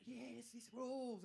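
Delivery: drawn-out, slow delight; slow gleeful yes. Retold or imitated. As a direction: savour each word, slowly. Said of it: "And you're like".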